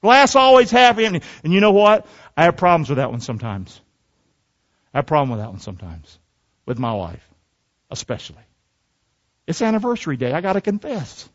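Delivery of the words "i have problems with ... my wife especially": low, hushed admission; confessing under the breath, trailing softer; quiet confession. Real, not acted. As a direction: drop your voice and own up sheepishly. Sheepish confession about his wife.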